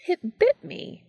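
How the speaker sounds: high, sudden yelp of surprise; startled exclamation. A narrator's reading. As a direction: cry out as if bitten. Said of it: "he exclaimed".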